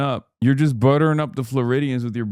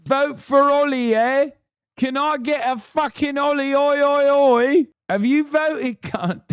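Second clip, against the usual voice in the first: rowdy London lad chanting oi oi; mock British accent; 12 semitones higher than usual. Streamer imagines candidate campaigning in Cockney accent. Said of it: "imagining you talking like that".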